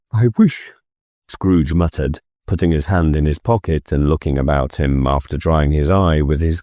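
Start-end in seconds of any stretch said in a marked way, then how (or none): 0.1-0.7 tearful. A narrator's reading. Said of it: "and cried".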